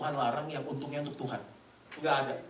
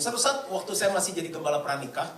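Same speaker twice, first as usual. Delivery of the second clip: ordinary delivery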